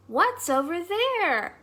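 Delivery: high, slow little mouse voice; small mouse calling to her friend. Acted. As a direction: call out as a tiny eager mouse. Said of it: "asked Mouse".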